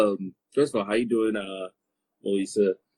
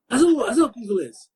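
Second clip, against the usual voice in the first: high gasping mock amazement; amazed gasp; 12 semitones higher than usual. People online reacting to her Lingala, amazed. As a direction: play their shocked delight.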